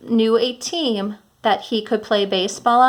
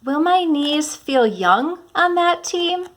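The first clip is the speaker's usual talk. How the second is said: higher, slower worried question; lightly voiced Mister Putter fretting; teacher voicing a character's anxious question; worried old man voice; 7 semitones higher, slower than usual. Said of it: "change your voice to sound like the characters".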